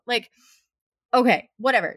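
normal talking voice

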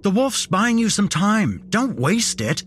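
high, urgent inner shout; mind screaming. A narrator's reading. Narrator's mind while the wolf fights, screaming. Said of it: "My mind screamed at me".

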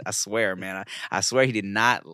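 normal talking voice

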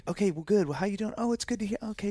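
imitating himself on the call; chirpy small talk. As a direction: act busy but eager.

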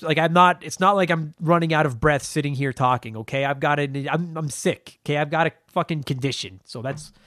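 ordinary delivery

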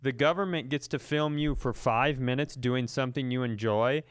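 ordinary talk, nothing marked

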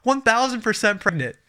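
very high, slow, emphatic; husband correcting himself, high and insistent; exasperated certainty. Retold or imitated. Scolding himself for doubting.

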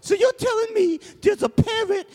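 very high, incredulous British official; squeaky mock British Governor; high-pitched Governor. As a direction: recap it in a silly high British voice. Governor repeats the report in sketch.

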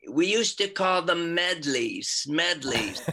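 slow, high-pitched mimicry of her inimitable accent; Annie's accent. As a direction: put on her accent, stretching it out. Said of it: "inimitable accent, she said".

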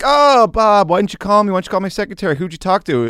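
high, loud whiny mock Trump; hammed-up mock Trump pleading with a reporter; Trump impression. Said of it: "Because he's like".

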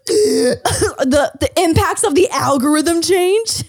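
high, disbelieving repeat with a laugh; incredulous echo. Retold or imitated. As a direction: echo it back in shock. Incredulous echo of kid's question.

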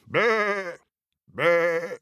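high frightened bleats; goat bleating. Acted.

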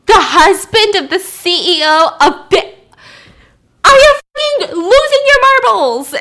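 raging boss, voice cracking at the top; boss yelling. Retold or imitated. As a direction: blow up at the captain down the phone. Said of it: "his boss yelling at him".

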